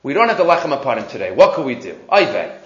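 normal talking voice

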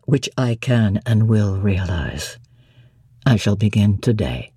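deep, low, doting voice; Rochester, fond. A narrator's reading. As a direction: croon it low to his bride.

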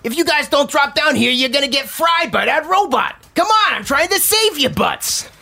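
high squeaky little voice. Acted. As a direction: squeak it out fast and urgent. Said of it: "a squeaky voice say".

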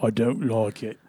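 put-on low, soft English accent; deep English accent. Showing the accent practised while driving, deep English voice. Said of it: "English accent".

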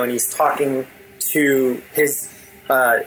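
ordinary talk, nothing marked

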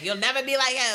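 retold scream. Woman screaming at him about his brother, retold as a mild yell. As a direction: imitate her screaming without really screaming. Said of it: "She's screaming at him".

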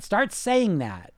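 "Start saying that" repeated slowly, word by word; slow down and let "saying" land on the repeat. Shown as a demonstration.

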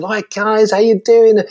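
put-on high, bright kids' entertainer hello; lively greeting. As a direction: rev up the school kids. Kids' show host greeting a school.

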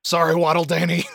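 hammed-up sorry father; mock-sorry dad voice. Joining the bit as Waddle Dad, mock sorry.

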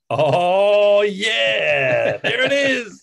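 high, loud hammed-up "oh yeah" payoff cheer; mock triumphant cheer. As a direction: cheer like the big reveal just landed, sarcastically. Mocking the audience's expected reaction.

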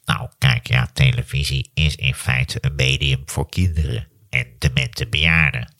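low, slow nasal drone; a hint of grumpy professor; Maarten van Rossum impression. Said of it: "ik doe hem verkeerd".

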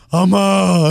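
put-on little boy voice calling his mom; mock child calling out to his mother; kid voice. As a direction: squeeze the voice like a seven-year-old. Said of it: "Habla bien, güey, la voz".

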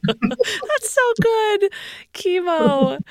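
slow, high, laughing through the words; can't stop laughing while praising. Real, not acted. Podcast host delighted by guest's story, laughing.

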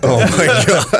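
high, loud, drawn-out laughing exclamation; laughing disbelief. Real, not acted. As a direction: crack up in amused disbelief. Cracking up at matchmaking idea.

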